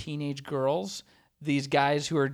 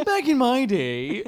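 put-on old-timer voice; 8 semitones higher, 5 dB louder than usual. Mock old-timer remark.

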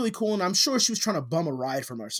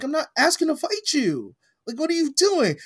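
exasperated; 11 semitones higher, 3 dB louder than usual. Retold or imitated. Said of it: "I'm like".

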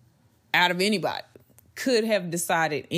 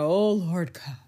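overly pious prayer. Pretend. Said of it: "not from a standpoint of".

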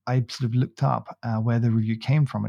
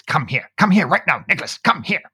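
a light imitation of a stern mum calling him in; a hint of an angry mum shouting for her son; mum calling sternly; 3 semitones higher than usual. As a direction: lightly play the cross mother summoning her son. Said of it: "she's called Nicholas".

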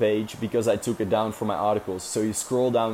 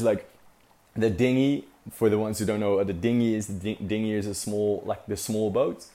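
ordinary delivery

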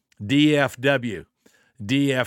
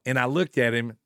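ordinary delivery

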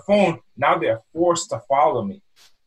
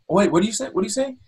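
puzzled passer-by; 6 semitones higher, faster than usual. Acted. Puzzled passers-by straining to hear.

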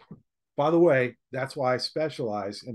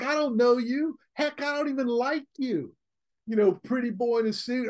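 higher, dismissive gruff put-down; mocking car owner; 9 semitones higher than usual. Speaker imitating the classic car owner's refusal, higher and dismissive. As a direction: sneer it at the pretty boy.

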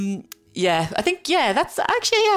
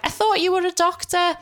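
much higher-pitched indignant mum imitation; mum kicking off; 10 semitones higher than usual. Daughter imitates mum challenging her medical advice, kicking off. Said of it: "she'll kick off and she'll be like".

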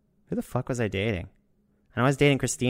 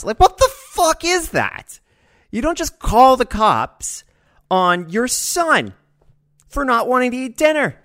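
much higher, louder and slow; outraged rant; 10 dB louder, slower than usual. Real, not acted. Ranting to viewers about his dad, outraged and loud. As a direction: let the disbelief boil over.

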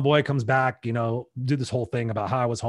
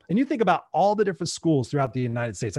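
usual speaking voice

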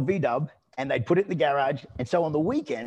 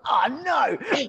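mock panic; 11 semitones higher than usual. Trainer recalling his fear of the giant, high mock-panicked yelp. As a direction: squeal it like you're in trouble. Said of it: "I was just like".

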